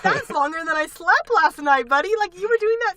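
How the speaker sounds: high, loud disbelieving jab; mock incredulous. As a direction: tease the eight-hour test-taker. Mocking slow test-taker.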